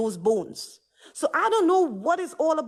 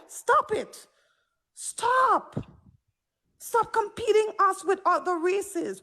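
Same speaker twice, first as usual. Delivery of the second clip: exasperated stop; 6 semitones higher, slower than usual. Real, not acted. Exasperated plea to stop competing.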